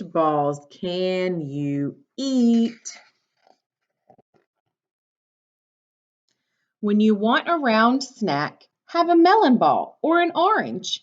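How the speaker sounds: bright, lively storybook read; reading to children. Acted. Teacher reading a picture book to her class, bright and lively.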